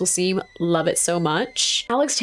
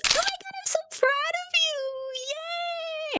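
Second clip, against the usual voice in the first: very high, much softer squeal of delight; gushing proud mom; 7 dB quieter, slower than usual. Retold or imitated. Mother praising her son for shooting, high gushing squeal. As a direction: coo over him like a doting mother. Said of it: "she goes".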